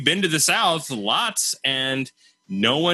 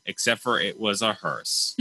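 ordinary delivery

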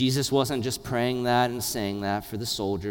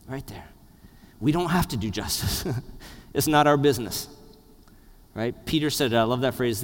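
ordinary talk

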